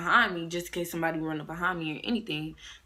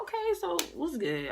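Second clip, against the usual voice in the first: mock challenge; 12 semitones higher than usual. Challenging rival by text.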